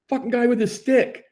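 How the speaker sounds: high, loud furious complaint; angry protest. Retold or imitated. Ejected player argues with referee, furious. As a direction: vent at the ref.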